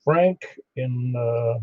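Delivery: normal talking voice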